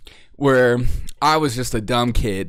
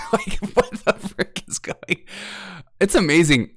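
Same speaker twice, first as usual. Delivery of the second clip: normal talking voice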